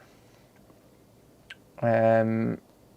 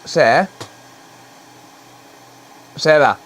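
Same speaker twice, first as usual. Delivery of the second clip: calling out; 3 semitones higher, 6 dB louder than usual. Real, not acted. Streamer calling his partner from the desk, calling out. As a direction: call her name up the stairs, louder.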